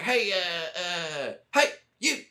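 calling out in a high mock Texas twang; imitating Texan first sergeant. First sergeant calling out who lacks a helmet, high Texan voice. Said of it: "he's like".

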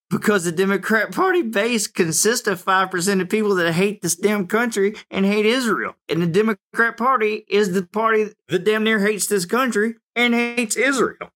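worked-up political tirade; heated rant. Real, not acted. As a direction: build to indignant fervor.

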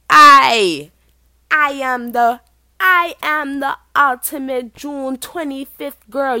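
loud, high, slow mock-regal declaration; grand proclamation. Birthday girl with a crown claiming her date, loud proclamation. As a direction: proclaim your title with huge emphasis.

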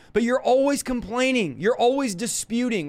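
usual speaking voice

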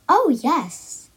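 put-on little girl jumping at the chance to bake; excited. A narrator's reading. Girl answering mother, excited. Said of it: "answered the little girl excitedly".

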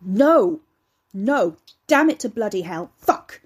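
loud, exploding outburst of cursing; furious swearing. A narrator's reading. Ricky swearing at a badly butchered badger, furious. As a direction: curse at it with full-voiced fury. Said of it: "cursed and swore at the remains".

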